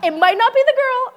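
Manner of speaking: high and loud sheepish exclamation; bright sudden admission; exclaiming. Real, not acted. Admits her own fault, exclaiming.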